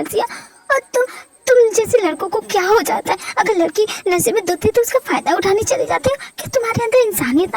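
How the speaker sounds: high, wounded scolding; hurt angry woman. Acted. Heroine scolding the doctor for taking advantage, wounded and sharp. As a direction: lay into him, hurt and indignant.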